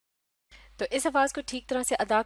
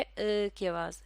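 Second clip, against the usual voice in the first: ordinary talk, nothing marked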